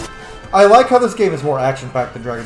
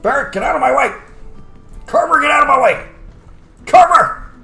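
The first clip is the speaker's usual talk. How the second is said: high, loud yell at teammates; exasperated shout; 13 semitones higher, 8 dB louder than usual. Real, not acted. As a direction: yell at someone blocking your path. Annoyed yelling at companions in game.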